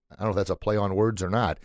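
ordinary talk, nothing marked

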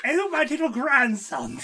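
Terry Thomas. Acted. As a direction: draw it out as an English comic playing granny. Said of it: "where Terry Thomas plays a grandma".

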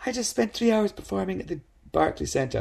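high, put-on weary voice; mock exhausted star voice. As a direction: play the tired rock star turning him down. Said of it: "And he's like".

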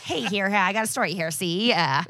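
hammed-up Brooklyn newsboy calling out a story; newsie voice. Podcaster doing her Conan newsie impression through laughter. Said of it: "my Conan O'Brien doing a newsie".

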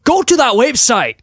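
high, loud Scottish accent attempt; Groundskeeper Willie. Host sending listeners to iTunes, shouted mock Scottish. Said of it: "Groundskeeper Willie".

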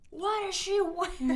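shocked dad. Retold or imitated. Dad seeing daughter whip off her jacket, high slow appalled. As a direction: play the horrified dad spotting the outfit. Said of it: "he was like".

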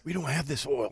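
confiding undertone. Retold or imitated. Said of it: "they act like I can't hear 'em".

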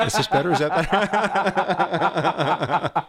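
hammed-up eye doctor asking again, slowing into laughter. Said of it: "All the time he's saying".

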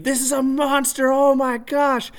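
high, excited yelling about a huge fish; excited screaming. Retold or imitated. Running down the bank, shouting to his wife about the monster fish, high and excited. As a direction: shout it up high like you can't believe the size. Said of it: "running down the bank screaming".

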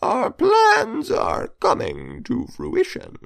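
slow, pleased gravelly villain; gravelly Emperor voice. A narrator's reading. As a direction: savor each word with a smirk. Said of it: "with a gravelly voice and a smirk".

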